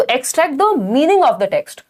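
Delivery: slow, careful read of the answer; rereading the answer. Shown as a demonstration. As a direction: linger on each word of the answer. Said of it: "हाँ, तो". (उत्तर धीरे से दोहराना)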